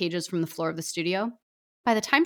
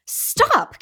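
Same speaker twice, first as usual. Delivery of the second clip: sudden high shout; cry out; 8 dB louder than usual. Acted. Author stopping Voldemort's curse in her studio, high sharp shout. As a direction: yell to stop him mid-curse. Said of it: "Caroline cried".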